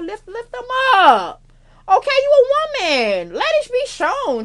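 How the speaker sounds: high, loud and drawn out, hyping her up; excited high cheer; hyped encouragement. Real, not acted. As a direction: pump them up like a cheerleader.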